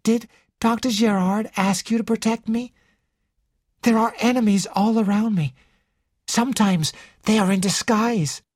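high character voice, confiding; anxious young Ginevra. Acted. Ginevra confides in detective about her admirer, high and earnest.

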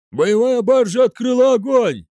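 high, strained officer's yell; shouted alarm. A narrator's reading. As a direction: shout the report across the bridge. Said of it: "прокричал один из офицеров". (кричать в боевой тревоге)

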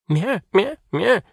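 high excited mewing; agitated kitten squeal. Acted. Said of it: "взволнованно заверещал котёнок".

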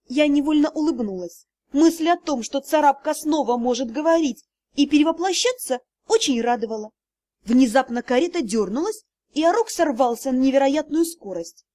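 plain reading voice

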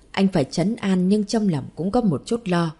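ordinary delivery, nothing marked

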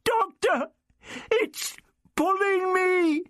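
high, slow, terrified wail; half sobbing, afraid. A narrator's reading. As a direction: cry for help as something drags you away. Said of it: "half sobbing with fear".

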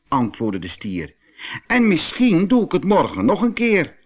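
excuse-making bull. Acted. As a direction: talk your way out as the proud bull.

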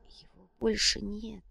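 slow, very soft, grief-stricken whisper; hushed and drawn out. A narrator's reading. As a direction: whisper it as if numb with loss. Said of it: "прошептала девушка".

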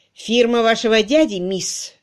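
usual speaking voice

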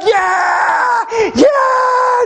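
high, drawn-out mock cheer; very high-pitched whooping "yeah"; imitating celebrating kids. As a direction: cheer like kids hearing it's a snow day. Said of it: "they're, they're celebrating".

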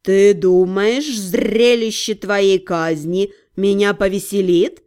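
slow, low, bored drawl; lazy bored demon cat, very slow; snooty bored cat. A narrator's reading.

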